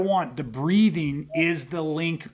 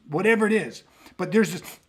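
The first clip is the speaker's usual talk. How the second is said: ordinary talk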